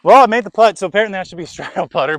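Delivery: loud, high delighted laugh; thrilled after sinking it; excited. Real, not acted. Made putt, laughing at himself.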